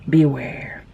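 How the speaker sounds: hushed, ominous whisper; a playful spooky whisper warning viewers who are eating. Pretend. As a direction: whisper it like a mock threat.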